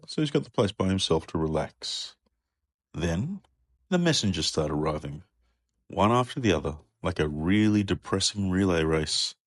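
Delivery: usual speaking voice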